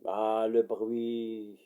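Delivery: slow, stretched-out groan of complaint; long, lingering "ah, le bruit"; drawn-out lament. Acted. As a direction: drag out each word, worn down by the din. Lamenting the relentless mine noise.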